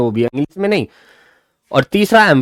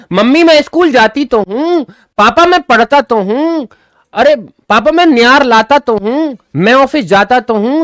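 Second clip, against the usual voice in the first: high and loud, leaning hard on each तो; pleading "मैं school जाती तो हूं" with तो stressed high and loud; emphatic insistence; 9 semitones higher, 5 dB louder than usual. Shown as a demonstration. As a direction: insist like a child protesting, punching तो. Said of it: "जोर देकर बोला जाता है".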